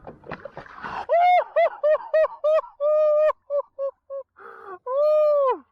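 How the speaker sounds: high, loud, crying-out laugh of pure delight; shrieking laugh. Real, not acted. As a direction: laugh until it breaks into a cry. Wild laugh over the catch.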